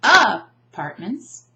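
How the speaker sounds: "apartments" wrongly as A-partments, loud first syllable; "apartments" with misplaced stress on opening A. Shown as a demonstration. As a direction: punch the A at the start of "apartments". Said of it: "because we don't say".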